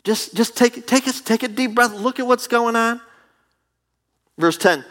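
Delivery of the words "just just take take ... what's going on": calming God. Acted. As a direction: talk an angry friend down. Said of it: "And God's like".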